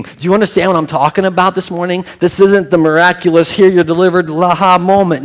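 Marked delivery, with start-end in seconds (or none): 2.2-5.2 mock triumphant. Mocking miraculous fanfare.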